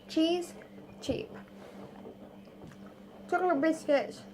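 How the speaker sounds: ordinary delivery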